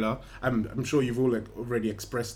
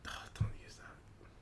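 hushed whispered protest; 17 dB quieter, slower than usual. Real, not acted. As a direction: whisper it under your breath. Playful objection to slang.

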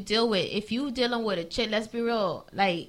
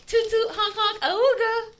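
a touch of a high silly honk; lightly voiced cartoon horn call; silly clown; 11 semitones higher, slower than usual. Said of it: "and she goes".

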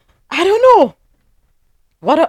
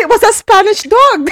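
laughing; 12 semitones higher, 4 dB louder than usual. Real, not acted. Laughing repeat of joke.

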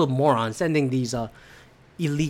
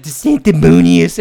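louder mock Trump taunt; 9 dB louder than usual. Podcaster mocking DeSantis like Trump, louder sneer. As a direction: lightly play Trump sneering at a rival. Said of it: "I sound like Trump making fun of it".

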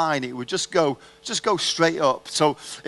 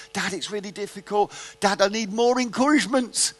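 pleading son; 5 semitones higher than usual. Retold or imitated. Son bargaining while swimming.

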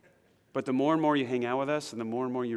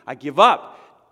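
much louder, slow shout of surrender; giving-up cry; 2 semitones higher, 15 dB louder, much slower than usual. Pretend. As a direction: throw your hands up and shout it.

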